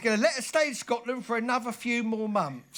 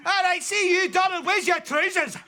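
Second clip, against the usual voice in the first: loud Scots call, pitched higher; broad Scottish accent; 8 semitones higher, 6 dB louder than usual. Acted. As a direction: shout in broad Scottish brogue. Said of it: "only me that ever learned to speak Scottish".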